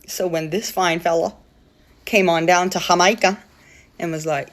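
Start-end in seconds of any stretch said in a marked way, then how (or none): none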